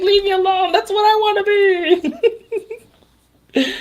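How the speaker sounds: put-on high whiny kid voice, half laughing; whiny child voice. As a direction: whine it like a hurt little girl. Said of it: "She's like".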